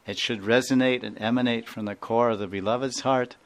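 normal talking voice

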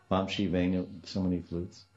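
normal talking voice

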